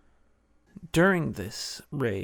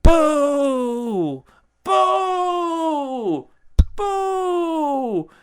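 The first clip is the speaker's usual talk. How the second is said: very high, loud mock booing; shrieking boos, hammed up and loud; angry booing; 7 dB louder, much slower than usual. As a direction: boo the new queen like an angry crowd. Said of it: "I touch the mic 'cause I'm angry".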